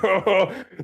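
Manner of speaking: high, hammed-up sobbing "oh"; comic blubbering cry; mock tearful wail. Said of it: "tears streaming from his eyes".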